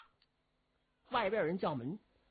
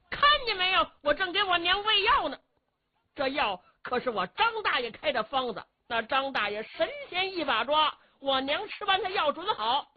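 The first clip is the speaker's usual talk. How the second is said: put-on higher, bold and cocky young man; brash filial son; 8 semitones higher than usual. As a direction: brush the officers off with swagger. Zhu Can rebuffs the officers.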